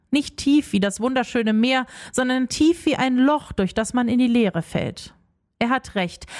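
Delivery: plain reading voice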